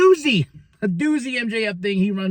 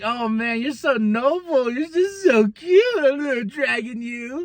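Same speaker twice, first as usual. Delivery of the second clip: higher, slow condescending coo; mocking; 5 semitones higher, slower than usual. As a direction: patronize him with a sugary mock voice. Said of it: "Swerve's like".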